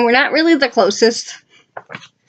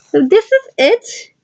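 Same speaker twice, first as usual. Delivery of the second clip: excited; 10 semitones higher than usual. Real, not acted. Presenting dad page.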